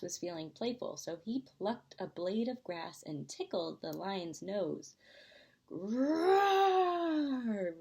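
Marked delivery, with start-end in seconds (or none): 5.7-7.8 big growling roar, high and loud; lion roar. A narrator's reading. Lion roaring at the tickling mouse, loud growl. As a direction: let out a full animal growl. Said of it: "roared the lion".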